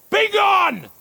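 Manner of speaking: high, loud barked dismissal; commanding shout. Acted. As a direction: drive them off with one order. Official dismisses the pair.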